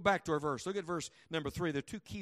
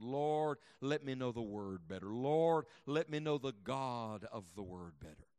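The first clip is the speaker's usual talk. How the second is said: hushed prayer; 5 semitones lower, slower than usual. Acted. Prays aloud.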